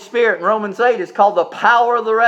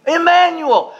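Emmanuel raised high and proclaimed; emphatic Emmanuel; 8 semitones higher than usual. Shown as a demonstration. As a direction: lift Emmanuel up high, then let it fall. Repeats the name with emphasis.

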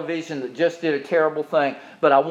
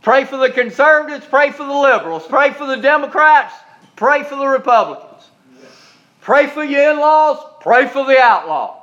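loud, high repeated preaching call; preaching at full force; 10 semitones higher, 5 dB louder than usual. Acted. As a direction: drive each line home from the pulpit.

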